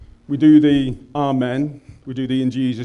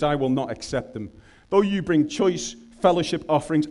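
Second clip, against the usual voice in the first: solemn scripture reading; 3 semitones higher than usual. Acted. Preacher reading Amos to the congregation as a solemn prophetic rebuke. Reads God's words from Amos.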